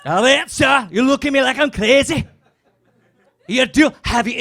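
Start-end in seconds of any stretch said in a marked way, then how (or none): none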